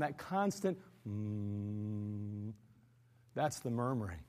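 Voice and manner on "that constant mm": low, quiet, very slow humming mm; low hum. Shown as a demonstration. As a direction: hum like a constant undertow. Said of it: "that sound".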